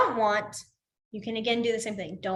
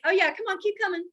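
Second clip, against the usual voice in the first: high, eager mock cheer; eager class; 9 semitones higher, much faster than usual. Teacher mimicking an eager class urging her on, high and eager. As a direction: egg the teacher on like keen students. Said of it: "other classes are like".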